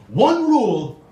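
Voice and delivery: mock French baller laying down the rule; French accent. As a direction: bark the rule like a French player, lightly comic. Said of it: "what he ended up saying was".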